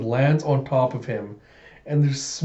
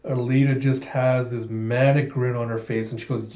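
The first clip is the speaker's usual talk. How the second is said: ordinary delivery, nothing marked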